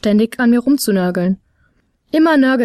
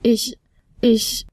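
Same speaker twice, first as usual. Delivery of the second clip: stockend; much slower than usual. A narrator's reading. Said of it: "begann der, ohne selbst zu wissen".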